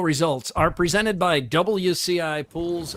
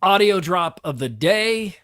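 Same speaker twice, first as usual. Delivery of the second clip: radio announcer; 4 semitones higher, 4 dB louder than usual. Acted. Announces the show's audio drop.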